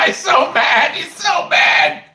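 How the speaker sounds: high, drawn-out laughing jeer; gleeful taunting. Real, not acted. Streamer taunting a blocked viewer, gleeful high jeer.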